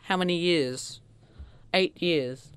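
ordinary talk, nothing marked

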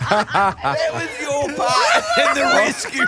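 laughing. Real, not acted. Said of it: "It hurts to laugh".

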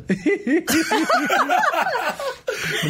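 high, wheezy cackling laugh that falls away; Eddie Murphy laugh. Acted. Impressionist shows hosts the famous high Eddie Murphy cackle. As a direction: throw out the big signature cackle. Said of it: "the Eddie Murphy laugh".

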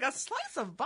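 high puzzled question, voice up; confused retelling. Retold or imitated. Host retelling her confusion over a pizza place name. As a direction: sound baffled by the odd name. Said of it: "I'm like".

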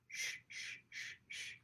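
soft unvoiced shushing. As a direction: shush the baby gently. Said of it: "by going".

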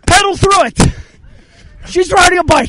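high, carrying coaching shout; calling out. Real, not acted. Coach urging a rider onward, excited calling out. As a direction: urge the rider on from a distance.

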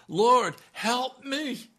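high, loud desperate cry for help; screaming plea. Acted. Desperate mother screaming to Jesus for help. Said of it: "She is screaming".

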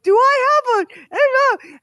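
half-yelp of panic at the hair; panicked cry. Retold or imitated. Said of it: "I screamed".

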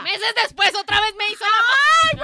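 dramatic gossip build-up. Real, not acted. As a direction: stretch it out, building suspense. Building to twist.

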